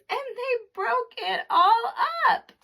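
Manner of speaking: put-on Baby Bear's tiny voice. A narrator's reading. Narrator voices Baby Bear crying over his chair, high and tiny. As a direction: squeak it out, upset little bear. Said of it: "cried the baby bear in his wee small voice".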